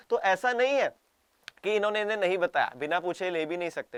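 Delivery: ordinary delivery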